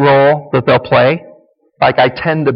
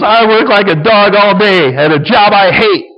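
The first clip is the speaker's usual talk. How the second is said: mock whiny husband, higher and louder; self-pitying husband; 6 semitones higher, 4 dB louder than usual. Husband playing victim over his job, mock whiny. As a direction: whine it as a hammed-up martyr. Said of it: "he's gonna play the victim".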